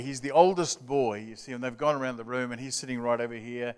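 usual speaking voice

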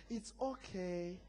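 very soft, slow put-on comfort; mock soothing. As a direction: soothe slowly, drawing out the reassurance. Imagines Jesus comforting the disciples.

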